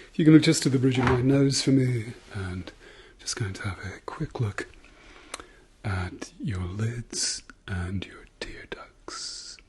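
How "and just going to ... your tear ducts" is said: whispering. Acted. ASMR doctor exam roleplay.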